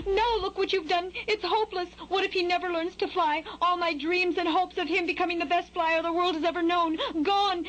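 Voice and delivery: high, wailing mother bird; hammed-up despair, pitched high; woeful. A narrator's reading. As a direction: lament woefully in a high, overdramatic voice. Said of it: "oh, so woefully".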